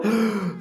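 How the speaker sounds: mimed out-of-breath gasp; exhausted gasp. Retold or imitated. Teacher imitating actor without oxygen, exhausted gasp. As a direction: suck in air as if worn out. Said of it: "ele tava tão cansado".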